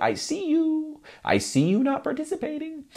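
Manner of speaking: high, light teasing; playful pressure on the crowd; teasing callout. Shown as a demonstration. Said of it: "give them that little moment".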